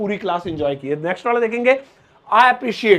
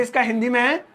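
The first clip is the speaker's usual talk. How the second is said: ordinary talk, nothing marked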